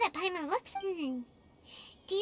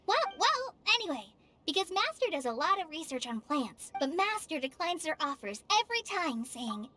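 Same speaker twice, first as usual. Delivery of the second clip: usual speaking voice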